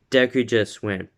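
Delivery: ordinary talk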